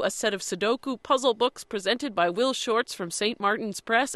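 rattled off without pausing; all in one breath. Real, not acted. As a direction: reel off the prize list in one breath. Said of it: "I'm gonna do it all in one breath".

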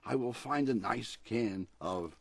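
lively picture-book read-aloud; storybook reading. A narrator's reading. Author reading his dog story on the radio, storybook voice. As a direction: read it like a bedtime story for a pet.

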